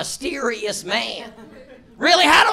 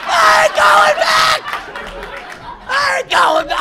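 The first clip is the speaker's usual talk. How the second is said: paranoid yell; 14 semitones higher, 6 dB louder than usual. Retold or imitated. Roommate Ed flipping the Monopoly table, high panicked yell. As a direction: shout it like he's being dragged to jail. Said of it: "He flipped the whole table over".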